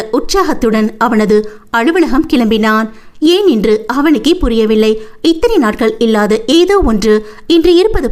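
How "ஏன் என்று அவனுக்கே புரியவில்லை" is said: plain reading voice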